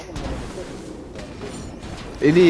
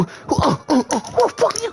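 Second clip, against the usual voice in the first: mock yell; 13 semitones higher than usual. Round two yelling.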